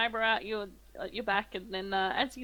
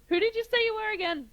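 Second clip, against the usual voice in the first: high-pitched questioning of a stranger; acted high-voiced query; querying mayor; 12 semitones higher, 4 dB louder than usual. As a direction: ask it pitched way up, as the puzzled mayor.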